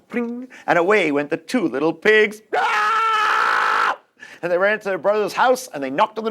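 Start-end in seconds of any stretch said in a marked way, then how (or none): 2.6-4.0 high startled scream. Acted. Pigs swept out of the stick house, screaming. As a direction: scream as you fly off.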